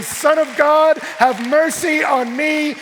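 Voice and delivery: loud, high, drawn-out plea; desperate crying out. Acted. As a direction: cry out for mercy at full voice. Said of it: "crying without covering, without excuses".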